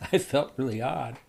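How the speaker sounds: ordinary talk, nothing marked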